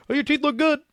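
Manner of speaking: high, loud, breezy dentist voice; mock dentist. Patient mocking the dentist's quick once-over, high and breezy. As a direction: chirp it like a dentist in a hurry.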